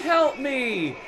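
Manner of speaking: very high, strained cry for help; hammed-up strangled scream; choked attempted scream. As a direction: squeal for help in panic. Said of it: "I tried to scream".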